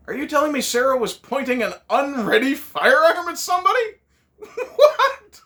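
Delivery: high, loud disbelief breaking into a laugh; incredulous outburst. Acted. Reviewer baffled by Sarah's gun, incredulous. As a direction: throw up your hands, then laugh.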